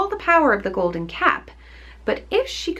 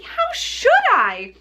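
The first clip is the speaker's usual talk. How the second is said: Dorothy answering; slower than usual. Acted. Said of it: "answered Dorothy".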